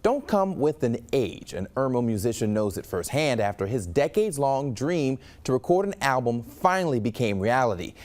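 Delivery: steady, polished newscaster delivery; news read. Acted. Anchor introducing a local musician's story, news read.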